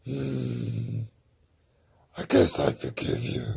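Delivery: deep monster voice, grudging; dragon voice. Acted. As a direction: rumble out a grudging pardon. Tree villain relents.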